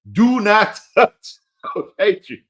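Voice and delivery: slow and loud, breaking into a laugh on touch; solemn farewell warning cracked by a laugh; drawn-out final words. Shown as a demonstration. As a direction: lay down your last word slowly. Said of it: "dedicated my final words to you".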